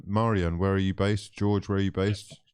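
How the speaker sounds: usual speaking voice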